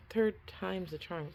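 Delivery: second try through numb lips; struggling to shape words, cold mouth; frozen mouth. Real, not acted. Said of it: "my mouth is frozen".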